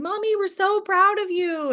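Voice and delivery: put-on high-pitched little child voice; mock kiddie voice full of pride; imagined proud kids. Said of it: "my kids in the first car".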